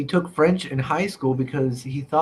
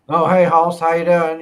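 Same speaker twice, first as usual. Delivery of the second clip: loud, higher hillbilly greeting; hillbilly accent, pitched up and loud; 4 semitones higher, 9 dB louder than usual. Acted. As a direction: holler it like a friendly hillbilly. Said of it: "my hillbilly accent and stuff".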